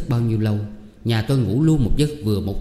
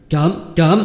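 high, loud alarmed shout; crying thief; 7 semitones higher, 6 dB louder than usual. A narrator's reading. Wife grabs the hand in the dark, shouting thief. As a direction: yell for help at the thief. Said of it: "Tôi nắm lấy kêu".

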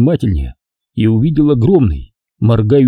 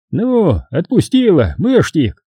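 put-on higher, slow kindly old man; 7 semitones higher, slower than usual. A narrator's reading. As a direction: ask gently like an old man. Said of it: "спросил старик".

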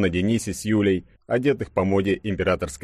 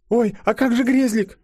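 put-on very high, worried girlish voice; squeaky anxious question; fretting woman; 4 dB louder than usual. A narrator's reading. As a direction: cry out in sudden concern. Katenka frets about her hamster.

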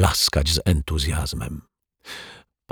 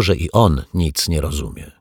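ordinary delivery, nothing marked

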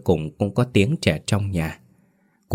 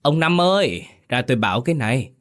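calling out; 6 semitones higher, faster than usual. A narrator's reading. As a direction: call him from the gate, then rush the rest fast. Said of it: "bà gọi với".

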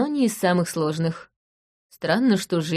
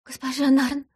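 high, imploring first call of her name; begging plea; 7 semitones higher than usual. Acted. Woman on her knees first begs the investigator. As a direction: reach out with the voice, pleading. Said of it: "протянув ко мне руки, проговорила".

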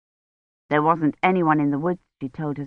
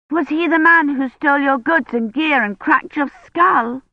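put-on higher, curious child voice; a wide-eyed little girl asking; curious child asking Kivrin; little girl Agnes; 8 semitones higher than usual. A narrator's reading.

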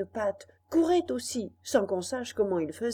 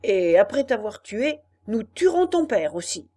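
menacing shout; 8 dB louder than usual. Acted. Robbers threaten his father.

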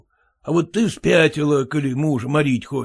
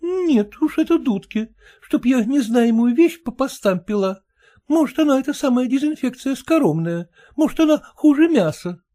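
much higher, stubborn wife; wife refusing; 9 semitones higher than usual. Acted. Wife refusing husband's disinfection, high stubborn voice. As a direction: dig in your heels as the wife.

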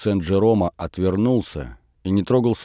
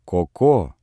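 put-on soften, coax the child; tender. A narrator's reading. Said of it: "сколько нежным голосом".

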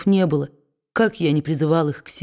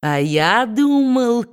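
slow, higher mocking drawl; mocking sneer; 6 semitones higher, much slower than usual. A narrator's reading. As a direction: sneer it slowly. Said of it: "с издевательской усмешкой продолжал карлик". (с издевательской усмешкой)

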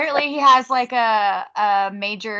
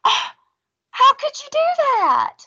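high, hammed-up wail of disbelief; mock shocked wail; 14 semitones higher than usual. Mocking the girlfriend's stunned reaction to her boyfriend, hammed-up high wail. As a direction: squeal it like a bad horror actress. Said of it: "She's like".

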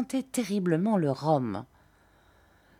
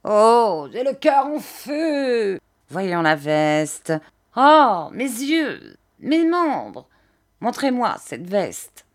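high, slow wails falling into demands; gusting singsong old man; 4 semitones higher, 5 dB louder, slower than usual. A narrator's reading. Old man asks to see the jacket, wailing singsong. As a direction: wail the ailments, drawing out each word. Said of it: "toutes ses phrases sur une espèce d'air".

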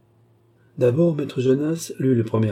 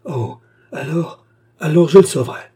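cry out; 7 semitones higher, 8 dB louder than usual. Acted. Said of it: "Le baronnet jeta un cri".

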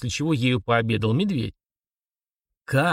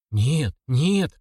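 babbling; 3 semitones higher than usual. A narrator's reading. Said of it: "залепетал Артур".

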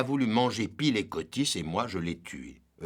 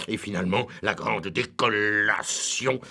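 ordinary talk

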